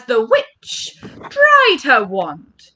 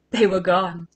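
ordinary delivery, nothing marked

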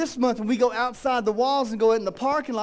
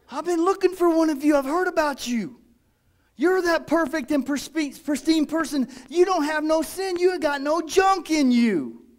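higher, mock amazed sarcasm; sarcastic mock admiration, pitched up; sarcastic awe; 6 semitones higher than usual. Real, not acted. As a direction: gush in fake wonder at the perfect one.

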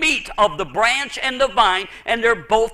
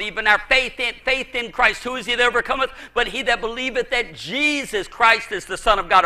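usual speaking voice

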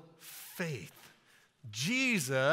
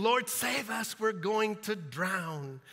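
raised, urgent cry for help; loud, pleading disciples in a storm; panicked plea; 4 semitones higher, 3 dB louder, slower than usual. Acted. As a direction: call out in alarm, stretching the last words. Disciples waking Jesus in storm.

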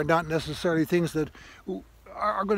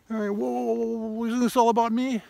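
mock self-centered whine; 7 semitones higher than usual. Mocking self-centered inner voice.

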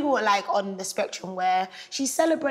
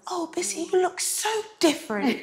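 mock coworker; 9 semitones higher than usual.

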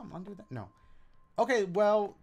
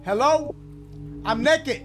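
louder, higher nervous call; 5 semitones higher, 8 dB louder, slower than usual. Real, not acted. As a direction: call out into the dark. Calling out in scary scene.

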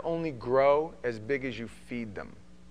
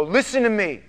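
much louder, higher, pressing warning; urgent shouting warning; 7 semitones higher, 14 dB louder than usual. Retold or imitated. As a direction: hammer it home to save her. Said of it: "And I'll go".

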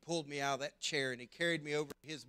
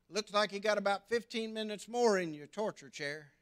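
teasing dad; 6 semitones higher, 5 dB louder than usual. Retold or imitated.

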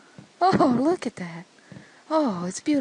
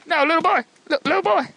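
put-on higher-pitched, urgent call to a child; mock alarm; 6 semitones higher, 3 dB louder, much faster than usual. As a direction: call out in play panic. Calling out to endangered game child.

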